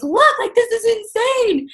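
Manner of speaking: high, thrilled squeal of disbelief; excited squeal. Retold or imitated. Wife jumping up and down showing her husband the results, high thrilled squeal. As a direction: show it off like you can't believe it.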